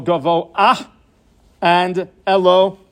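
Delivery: "Gavoah" and "Elo" drawn out slowly, each ending on an open ah glide; slow pronunciation demo. Shown as a demonstration. Teacher slowly modelling "Gavoah" and "Elo" with the ah glide before the last letter. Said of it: "as if it's an Aleph before the Hey".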